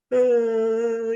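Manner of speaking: high mock wail of a crying boy; imitated child's wail. Father mimics his son starting to cry. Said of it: "começou a chorar".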